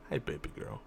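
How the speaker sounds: hushed tender pet greeting; tender cooing. Real, not acted. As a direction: murmur lovingly to the cat. Cooing at her cat Blue.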